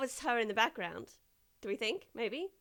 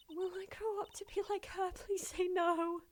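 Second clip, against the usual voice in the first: high, small character voice; worried assistant; 9 semitones higher than usual. Acted. Assistant asks the lawyer about growing up like her, high pleading voice. Assistant dreads becoming like the guard.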